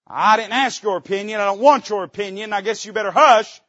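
an irritated king silencing the prophet; angry king. Retold or imitated. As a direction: shut the prophet down hard. King voiced in imagined dialogue.

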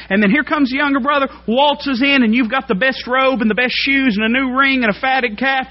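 put-on whining older brother. Older brother whining to his father about the party, high and loud. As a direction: play the jealous son griping he got nothing.

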